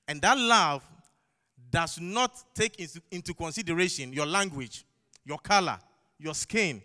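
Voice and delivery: loud, driving sermon climax; forceful preaching. Acted. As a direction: hammer each word out to the church.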